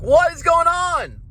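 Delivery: high, slow bewildered voice; mock bewildered. Reacting to a director's long shoot, high and slow. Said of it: "And we're like".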